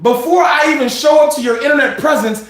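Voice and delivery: usual speaking voice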